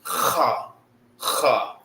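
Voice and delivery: kha from the back of the throat, like hawking up spit; guttural kha sound; throaty kha. Shown as a demonstration. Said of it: "Not cha, it's kha".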